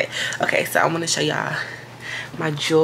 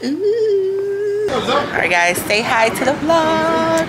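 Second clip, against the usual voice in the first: high, loud party greeting; excited; 11 semitones higher, 4 dB louder, much slower than usual. Real, not acted. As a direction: squeal hello to the camera.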